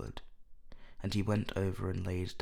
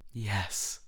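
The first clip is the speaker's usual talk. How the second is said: mildly dreamy answer; a touch of reverie. A narrator's reading. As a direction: say it as if half elsewhere. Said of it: "answered Lord Henry dreamily".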